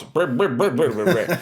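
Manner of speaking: rolled brr-brr yapping noise; mimicked blabbering. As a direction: imitate someone ranting on and on. Mimics friend's endless ranting.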